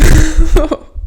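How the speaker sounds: brief amused laugh; short laugh. Real, not acted. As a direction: chuckle at the mishap. Laughs at her device.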